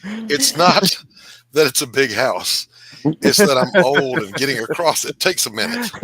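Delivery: loud call from another room; yelling across the room. Real, not acted. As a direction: shout it across the house. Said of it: "Is he yelling across the room?".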